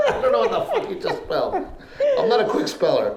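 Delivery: high, laughing protest; flustered and giggling; laughing confusion. Retold or imitated. Self quoted confused by spelling.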